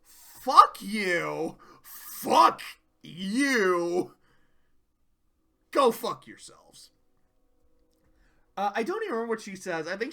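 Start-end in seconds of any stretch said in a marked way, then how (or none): 0.0-6.9 louder spat-out curses; mock fury. Fluttershy to her friends, a loud mock angry rant. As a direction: throw it at them. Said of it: "And then she's like".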